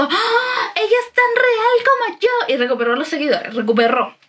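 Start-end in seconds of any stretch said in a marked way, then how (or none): 0.0-2.5 high-pitched gushing fan voice; mock fan gasp. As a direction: squeal it like an awed fan. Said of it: "la gente dijo". (voz de fan emocionada, mucho más aguda)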